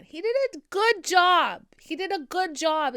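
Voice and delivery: loud, high, punchy declaration; emphatic praise. Acted. Speaker revises the verdict on Harold's acting, loud and high. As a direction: belt it out as a firm verdict.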